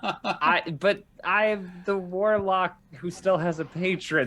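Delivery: laughing through words. Real, not acted. Player joking about a warlock's patron, laughing. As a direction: talk while trying not to laugh.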